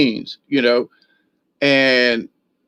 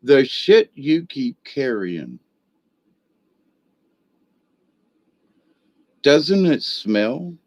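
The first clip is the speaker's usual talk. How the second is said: slow, gentle and unhurried, like a caring friend; calm and loving; slower than usual. Retold or imitated. A friend gently asks [name] about the shame he carries, slow and loving. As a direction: let each word land slowly and kindly. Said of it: "looked at me very calmly and lovingly".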